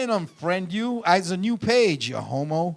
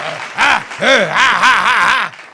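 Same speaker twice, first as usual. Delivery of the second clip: loud wordless caveman cries; caveman character grunts; 9 dB louder, much slower than usual. Retold or imitated. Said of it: "you were in your character".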